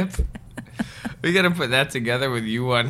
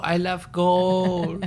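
Mike Myers Dutch voice; 6 semitones higher, much slower than usual. Retold or imitated. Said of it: "his Dutch accent".

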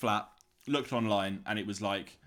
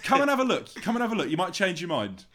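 raised, insistent mock sales coaxing; 7 semitones higher, 4 dB louder than usual. Estate agent to a reluctant viewer, mock coaxing. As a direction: imitate an agent urging a viewer in.

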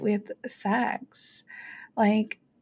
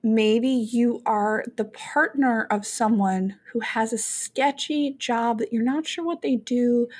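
ordinary talk, nothing marked

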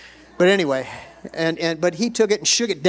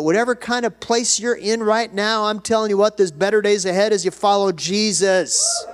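raised, urgent preacher voice; rally the congregation with promise; high-pitched pulpit encouragement; impassioned exhortation; 6 semitones higher, 4 dB louder than usual. Real, not acted.

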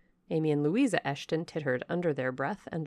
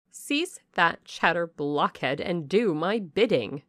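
louder, slow, contemptuous scolding; haughty rebuke; 6 dB louder, slower than usual. Acted. Blanche scolds the footman, slow, loud and contemptuous. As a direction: put the servant in his place.

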